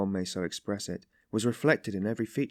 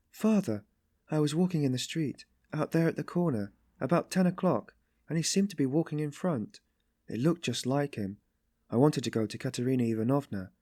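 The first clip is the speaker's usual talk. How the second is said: put-on higher-pitched young woman's story voice; timid Sonia, hesitant; 6 semitones higher than usual. Sonia explains where she was walking, hesitant. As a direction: read as Sonia, confiding and unsure.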